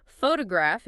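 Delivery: "photograph" with the stress on its first syllable, PHO. Shown as a demonstration. Teacher explaining word stress, "photograph" stressed on the first syllable. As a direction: hit the opening syllable of "photograph". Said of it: "is stressed on the first syllable".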